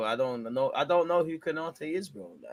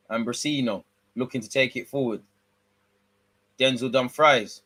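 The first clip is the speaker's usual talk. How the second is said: ordinary talk, nothing marked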